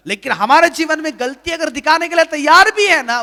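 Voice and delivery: loud, rising preacher's call to confess; fervent sermon delivery; preaching at full force. Acted. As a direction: drive each line home to the congregation. Preaching Jesus' declarations.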